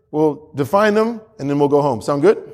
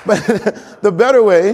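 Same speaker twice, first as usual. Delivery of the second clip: ordinary talk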